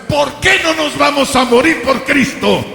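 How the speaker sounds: high, ringing rhetorical challenge; impassioned preaching. Real, not acted. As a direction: cry it out to the congregation.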